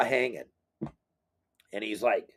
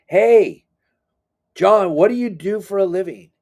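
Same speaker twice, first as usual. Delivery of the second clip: bright hail raised high and louder; calling out; 7 semitones higher, 6 dB louder than usual. Retold or imitated. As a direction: call across the deck to get his attention. Calling out to client on boat.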